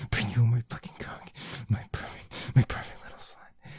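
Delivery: loud, forceful bursts with breathy edges. Acted. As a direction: push each phrase out hard at the partner. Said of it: "I get a little bit more aggressive".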